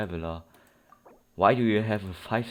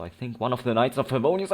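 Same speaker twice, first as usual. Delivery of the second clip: normal talking voice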